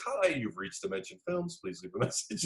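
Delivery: imitating an answering machine. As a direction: put on a voicemail voice. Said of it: "It's like".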